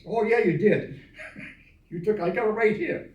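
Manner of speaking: amused, assuring him he passed; laughing insistence. Retold or imitated. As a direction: examiner laughing while insisting, loud. The examiner laughs, insisting they passed.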